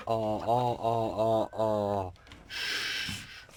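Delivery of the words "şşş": hushing hiss. Real, not acted. Hushing near ants.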